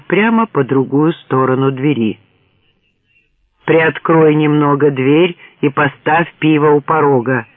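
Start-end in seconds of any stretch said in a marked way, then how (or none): none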